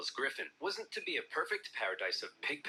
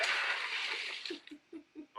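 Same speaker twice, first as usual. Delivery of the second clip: high wheezy cackle; 14 semitones higher than usual. Pretend. Speaker's wild laugh.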